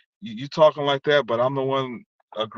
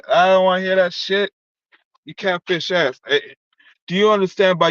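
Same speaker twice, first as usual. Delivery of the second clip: angry shouting; 5 semitones higher, 6 dB louder than usual. Real, not acted. Shouting at ex on stream.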